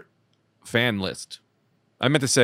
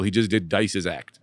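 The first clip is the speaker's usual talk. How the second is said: ordinary delivery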